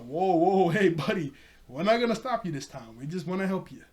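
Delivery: retold urgent reassurance; placating. Retold hurried calming of gunman.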